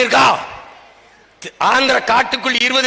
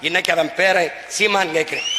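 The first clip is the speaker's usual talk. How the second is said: normal talking voice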